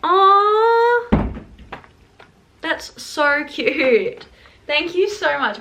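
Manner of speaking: slow, gushing delight. Real, not acted. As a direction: coo over the gift, touched. Delighted at surprise donuts.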